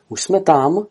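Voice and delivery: a hint of a little one asking; young goose. A narrator's reading. Young goose pesters her father, lightly voiced.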